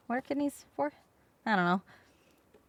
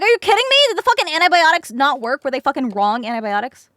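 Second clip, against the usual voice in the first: loud, higher, outraged disbelief; furious outburst; 3 semitones higher, 8 dB louder than usual. Retold or imitated. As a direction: yell it, outraged at the nurse. Furious at failed antibiotics.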